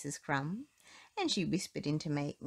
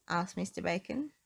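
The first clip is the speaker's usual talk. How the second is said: usual speaking voice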